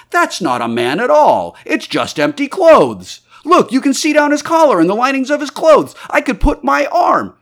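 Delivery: loud, rising to very high-pitched amazement; amazed discovery. A narrator's reading. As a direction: call everyone to look, astonished. Huckster discovers the clothes are empty.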